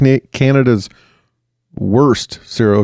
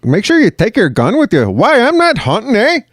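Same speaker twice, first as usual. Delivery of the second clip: put-on high-pitched, swooping Canadian accent; incredulous Canadian in a high comic voice; mock Canadian; 11 semitones higher than usual. Co-host acts out Canada refusing a gun, high mock Canadian. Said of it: "Canada is like".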